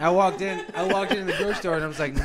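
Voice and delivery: loud, laughing retelling; excited. Real, not acted. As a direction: burst out with excited disbelief.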